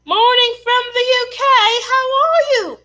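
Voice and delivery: very high, loud mock British greeting; exaggerated high British accent. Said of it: "always trying to do a U K accent".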